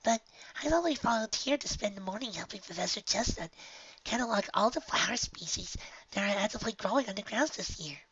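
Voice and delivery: hushed, high and wistful; wishful young filly, quietly; soft high filly voice. Acted. Honeydew voice.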